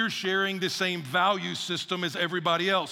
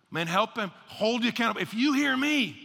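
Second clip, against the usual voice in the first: ordinary talk, nothing marked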